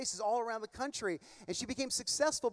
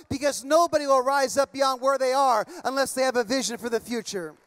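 loud, driving sermon build toward a shout; preaching at full force; 3 semitones higher, 8 dB louder than usual. Acted. Pastor urging the congregation toward vision, preaching loud. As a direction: preach it out hard to the whole room.

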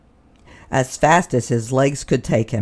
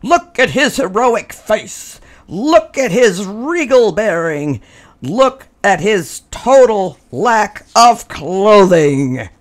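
high, insistent, slowing on the last line; demanding; 8 semitones higher, 5 dB louder than usual. A narrator's reading. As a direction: lecture him with pomp. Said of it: "they demanded".

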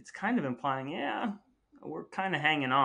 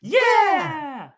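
cheering yeah; 10 dB louder than usual. Acted. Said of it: "think of some song".

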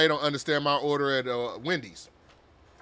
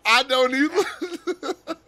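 real laughter breaking through, high and drawn out; high laughing reply; 9 semitones higher, 3 dB louder, much slower than usual. Real, not acted. Cracking up at the cartoon.

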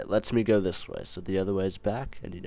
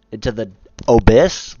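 loud disbelief; 14 dB louder than usual. Real, not acted. Startled by the jump choice.

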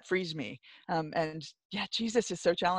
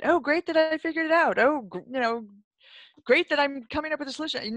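mock savior; 8 semitones higher than usual. Mocking a self-satisfied savior.

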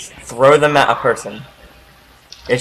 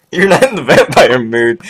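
laughing mock echo; 5 dB louder than usual. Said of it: "That does not sound like Soul at all".

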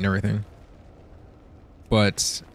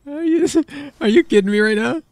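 high, loud incredulous question; laughing disbelief; 13 semitones higher, 4 dB louder than usual. Real, not acted. Player reacts to absurd glitch, incredulous outburst. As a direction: pitch jumps up in real disbelief.

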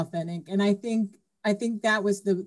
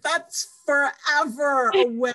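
put-on whiny little girl; 7 semitones higher, slower than usual. Kid complaining about wait.

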